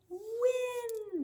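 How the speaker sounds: "Win." swooped high and held with a flip at the end; roller coaster read. Shown as a demonstration. Teacher roller coaster reads for the kids, sweeping up high. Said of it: "when I say it fast".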